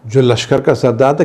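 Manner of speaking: ordinary talk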